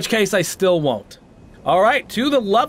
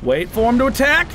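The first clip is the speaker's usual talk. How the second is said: ordinary talk, nothing marked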